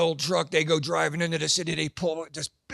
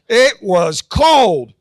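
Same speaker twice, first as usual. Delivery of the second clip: "cold" slow and much louder, laughing; retrying the punchline louder for the crowd; 9 dB louder, much slower than usual. Shown as a demonstration. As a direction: hit "cold" harder this time, drawn out. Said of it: "Let's try it again".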